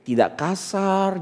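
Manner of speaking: slow, soft, lingering words; hushed and unhurried, mock-delicate; gentle. Shown as a demonstration. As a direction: demonstrate refined speech, stretching each phrase. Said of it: "Lemah lembut, betul?".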